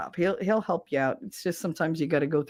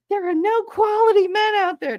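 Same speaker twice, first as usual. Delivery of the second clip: mocking whine; 5 dB louder than usual. Mocking the ex-wife's complaint.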